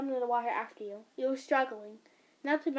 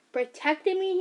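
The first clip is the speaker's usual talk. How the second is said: rising, disbelieving echo; incredulous question; 6 semitones higher, 5 dB louder than usual. Acted. Son questioning mother's excuse, incredulous. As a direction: throw her word back at her. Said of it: "he said".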